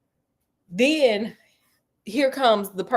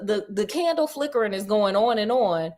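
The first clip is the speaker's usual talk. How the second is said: normal talking voice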